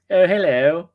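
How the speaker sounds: a hint of a Texas drawl on a flirty hello; mock Texas accent, lightly done; Texas lady accent. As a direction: lightly imitate a Texas lady greeting him. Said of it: "the Texas accent on ladies".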